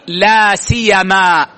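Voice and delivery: لا سيما slow, the ي light; teacher models لا سيما with a single ي; pronunciation demo. Shown as a demonstration. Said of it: "التشديد والتخفيف". (لا سيما بتخفيف الياء)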